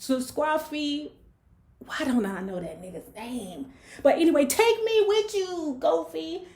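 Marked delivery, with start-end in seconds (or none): 0.1-1.1 put-on high, slow begging girl voice; pleading girl. Winter begging Midnight. 4.4-6.4 put-on high, slow begging girl voice; pleading girl. Repeats Winter's plea.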